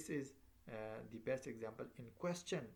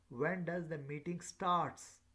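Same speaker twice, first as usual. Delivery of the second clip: slow, louder question lifted on STARTS; rising question intonation; 4 semitones higher, 6 dB louder, slower than usual. Shown as a demonstration. Teacher showing how voice comes up when asking, rising intonation. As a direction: hold "starts" long on a high pitch. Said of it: "Rise up, rises up".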